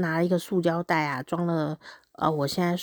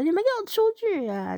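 mock yell; 14 semitones higher, faster than usual. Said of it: "请这个家长先离席一下".

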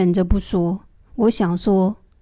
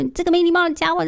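high, fast mock curse, pitched way up; cursing her; 8 semitones higher, faster than usual. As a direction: spit out the insult as if scolding her in your head. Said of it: "然后一直骂她说". (在心里咒骂插队大妈，尖声快骂)

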